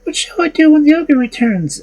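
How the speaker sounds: put-on high, worried girl voice; anxious young maiden. A narrator's reading. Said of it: "asked she".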